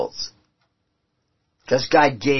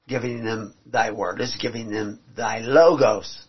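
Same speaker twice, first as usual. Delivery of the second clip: ordinary delivery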